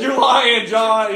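high, loud heckle; retold accusing yell. Villagers heckling the healed man, mock accusing yell. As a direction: yell the accusation across the crowd. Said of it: "they're basically in Nepali yelling".

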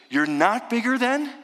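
high, lightly voiced girl asking; Lucy. Lucy puzzled, asking Aslan in a high voice.

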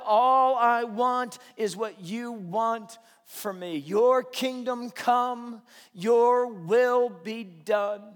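loud, slow, drawn-out preaching that builds to a chant; full-force preaching. Acted. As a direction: stretch out each phrase and hammer it home to the congregation.

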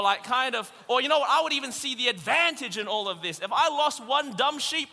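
animated preacher voice; lively preaching. Real, not acted. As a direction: work the crowd with humor.

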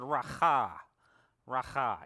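"racha" with a rough throaty ch, like spitting; guttural spitting sound. Shown as a demonstration. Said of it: "it is a guttural word ... spitting sound".

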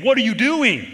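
high, loud cry flung at the sky; exasperated cry. Retold or imitated. Pastor shouting up at heaven after a bad year, exasperated yell. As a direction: shout your complaint straight up at God. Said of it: "looking up to heaven and saying".

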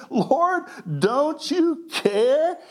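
high, slow accusing plea; accusing Martha. Acted. Martha accusing Jesus, slow high accusing. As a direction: drag it out like an accusation. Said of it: "she's making an accusation".

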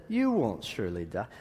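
low, soft, sly serpent whisper; a hushed, crafty serpent voice. Retold or imitated. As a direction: tempt her gently and low. Preacher voices the tempting serpent.

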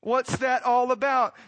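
high, loud and slow, driving each question home; preaching at full force. Real, not acted. Preacher pressing the congregation on why God came small, loud and slow. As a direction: climb to the pulpit's peak and hammer each why.

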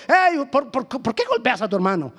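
stumbling, high-pitched reproach; acted confrontation. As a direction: call out and challenge someone fighting. Acts Moses breaking up a quarrel.

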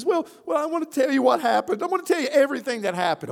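high, fast flustered confession; crying husband. Retold or imitated. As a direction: blurt it out like a tearful young husband. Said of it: "the guy starts crying".

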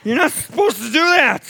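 mock scold. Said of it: "there was an awkward silence".

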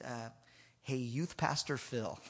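slow, soft, timid approach; very quiet. Retold or imitated. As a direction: cautiously address an intimidating coach, retold. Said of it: "very quietly approached Coach McKay".

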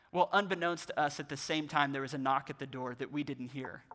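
normal talking voice

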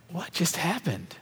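soft, slow, hushed amazement; awed disbelief. Retold or imitated. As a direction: let the wonder slow you down. Stunned after man walks off.

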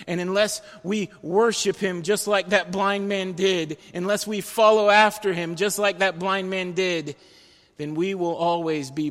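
full-force preaching. Acted. Pastor urging the congregation, loud full-force preaching. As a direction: drive it home from the pulpit.

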